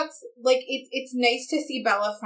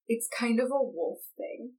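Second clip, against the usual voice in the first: slow, soft, offhand brush-off; dismissive; 3 semitones higher, 5 dB quieter than usual. Retold or imitated. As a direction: drag it out as a condescending aside. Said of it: "read it in that tone of voice".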